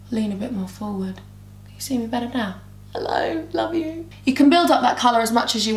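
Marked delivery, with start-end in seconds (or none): 0.1-2.6 quiet coaxing voice; soft coaxing. Real, not acted. Talking softly to dog Hans. 2.9-4.1 soft high cooing. Real, not acted. Cooing to dog.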